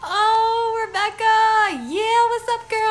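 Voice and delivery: excited greeting. Real, not acted. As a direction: squeal hello to a dear friend. Greeting a friend joining live.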